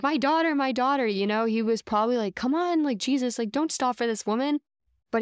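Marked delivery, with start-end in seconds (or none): 0.0-1.1 high pleading cry of my daughter, louder; a father crying out for his sick daughter, pitched up; desperate father. Retold or imitated. Retelling the desperate father begging Jesus, high pleading call. 2.3-4.6 higher, urgent mock pleading; imitating a father hurrying Jesus along; impatient father. The father urging Jesus not to stop, high impatient plea.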